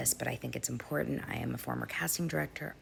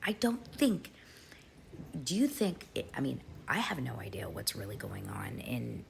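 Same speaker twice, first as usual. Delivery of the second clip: usual speaking voice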